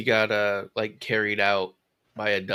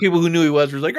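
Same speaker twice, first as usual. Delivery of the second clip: ordinary delivery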